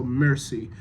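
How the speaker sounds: "Merci" said MER-see; front-stress "Merci", American scansion; stress on first syllable. Shown as a demonstration. Coach explains emphasis to actor, first-syllable stress. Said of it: "the emphasis is on the first".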